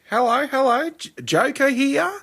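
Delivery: very high, slow cheery greeting; hammed-up Chopper doing the Joker; Eric Bana as Chopper. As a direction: Eric Bana's Chopper impression, high and drawn out. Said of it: "That's my best Eric Bana doing Chopper".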